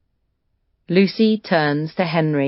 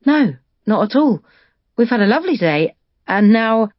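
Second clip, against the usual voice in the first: bright, higher, eager hostess voice; replying brightly; 5 semitones higher, 3 dB louder, faster than usual. Acted. Libby welcoming her daughter's father, brightly. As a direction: sound delighted to welcome him. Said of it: "Lucy hears Libby replying brightly".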